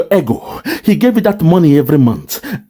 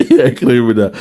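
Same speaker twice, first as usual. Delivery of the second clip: quick repeat broken by a laugh; laughing, faster; 4 semitones lower, faster than usual. Real, not acted. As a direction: laugh and rush the words again. Repeats accusation, still amused.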